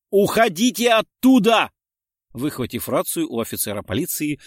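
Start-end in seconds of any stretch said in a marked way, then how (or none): 0.1-1.7 loud, high, drawn-out shout; screamed warning. A narrator's reading. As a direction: yell them out of danger. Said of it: "прокричал в неё Дронго". (отчаянный крик-предупреждение)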